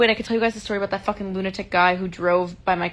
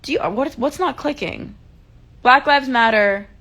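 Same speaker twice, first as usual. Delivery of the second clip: exasperated retort; 5 semitones higher, 5 dB louder than usual. Retold or imitated. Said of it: "I'm like".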